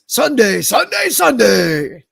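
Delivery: booming, slow monster truck promo; monster truck announcer. Acted. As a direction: hype it like a monster truck rally ad. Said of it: "Monster truck announcer voice".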